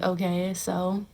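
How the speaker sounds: soft, low, unbothered shrug; calm indifference. Pretend. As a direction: say it quietly, not rising to it. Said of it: "I'd literally be like".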